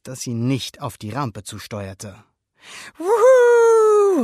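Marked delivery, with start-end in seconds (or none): 3.0-4.2 very high, loud, long whoop; jubilant whoop. A narrator's reading. Alberto cheers on the speeding Vespa, jubilant. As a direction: whoop with joy. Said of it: "rief Alberto jubelnd".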